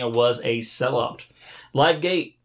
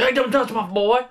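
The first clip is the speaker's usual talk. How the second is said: high put-on voice for a wrestler; hammed-up pleading wrestler; mock wrestler squeal; voiced wrestler quote; 4 semitones higher than usual. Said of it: "He's like".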